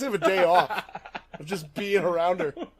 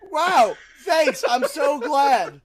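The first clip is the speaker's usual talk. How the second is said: sarcastic; 9 semitones higher, 6 dB louder, much slower than usual. Real, not acted. Sarcastic reaction to insult.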